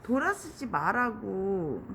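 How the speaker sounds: high, loud groan then slow scolding; fed-up scolding of a stubborn beetle; exasperated outburst. Real, not acted. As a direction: drag out 진짜 in real frustration. Beetle turns back again.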